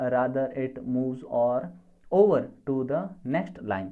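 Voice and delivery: very slow, word by word; spaced-out dictation read. Shown as a demonstration. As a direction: dictate it so students can write. Teacher dictating a definition.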